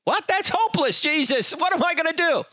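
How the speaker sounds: high, exasperated whine of a hopeless listener; squeaky what, that's hopeless; mock dismay. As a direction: throw up your hands in mock despair. Listener's mock despair at standard.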